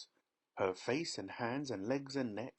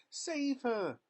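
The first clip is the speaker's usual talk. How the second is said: much higher pleading cry; desperate cry. A narrator's reading. Mother pleading for daughter, desperate cry. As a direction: beg for rescue. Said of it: "cried Missus Beauregard".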